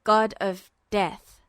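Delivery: low, slow and grim; Duo's voice. A narrator's reading.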